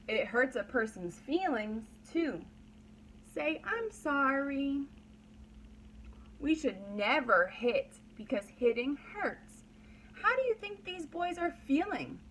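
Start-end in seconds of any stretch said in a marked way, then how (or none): none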